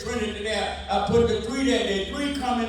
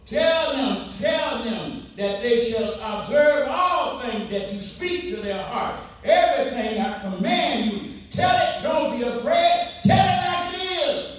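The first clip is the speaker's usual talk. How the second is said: God's charge roared from the pulpit; preaching at full force; 4 semitones higher than usual. Acted. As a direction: cry it out as a command. Sermon climax, God's command.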